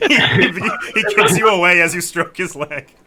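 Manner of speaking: real laughter through the narration, loud and high; laughing. Real, not acted. Game master reacting to the leg stroking, laughing loudly. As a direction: narrate while cracking up.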